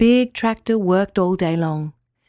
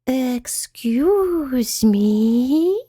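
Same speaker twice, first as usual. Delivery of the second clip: very slow, drawn-out, higher creature voice; whispery spider voice; 5 semitones higher, much slower than usual. A narrator's reading. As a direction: stretch each word out with long glides. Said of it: "whispered a voice from the top".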